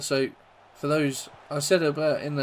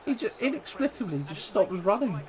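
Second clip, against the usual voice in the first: incredulous; 7 semitones higher than usual. Real, not acted. Disbelief at a defender stopping.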